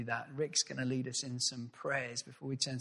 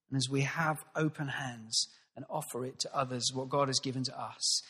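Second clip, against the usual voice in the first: usual speaking voice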